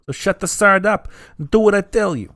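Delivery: a skull ordering the keeper around; bossy skull. Acted.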